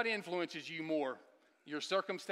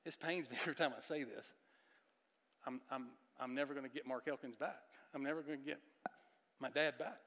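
sad; 2 semitones lower, 7 dB quieter than usual. Retold or imitated. Quoting his son's grief.

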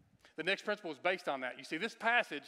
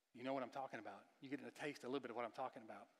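hushed preaching; 4 semitones lower, 12 dB quieter, faster than usual. Acted.

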